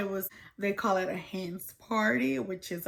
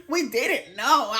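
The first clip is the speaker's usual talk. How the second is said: slow words through real laughter; laughing; 3 semitones higher, 5 dB louder than usual. Real, not acted. Tourist laughs admitting they didn't know, laughing. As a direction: laugh it out, embarrassed.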